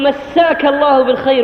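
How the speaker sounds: مساك said whole, massaak with doubled s; clear full greeting. Shown as a demonstration. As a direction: give every syllable of مساك الله بالخير. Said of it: "واضحة". (مسّاك الله بالخير واضحة كاملة)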